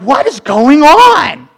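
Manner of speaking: high, loud, slow bewildered question; baffled cry. Retold or imitated. Confused believers asking aloud, high and drawn out. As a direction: stretch it out, totally lost. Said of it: "We're like".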